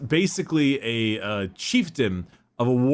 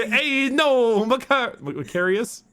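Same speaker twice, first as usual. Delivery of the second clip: much higher, laughing mock Italian rant; mock Italian Lorgar; 11 semitones higher than usual. Lorgar cursing at Guilliman in a high mock Italian voice. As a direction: ham up a furious Italian Lorgar.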